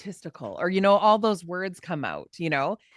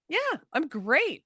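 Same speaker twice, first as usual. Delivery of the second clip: slow, higher, self-assured drawl; higher, unhurried "I'm great"; breezy confidence; 7 semitones higher, slower than usual. Retold or imitated. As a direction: toss it off proudly and slowly. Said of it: "of being like".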